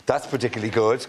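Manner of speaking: ordinary talk, nothing marked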